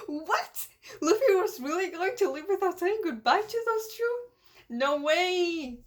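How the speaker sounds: high-pitched disbelief; acted high, incredulous outcry; shocked protest. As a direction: squawk it in mock outrage. Acts out characters' shocked protest.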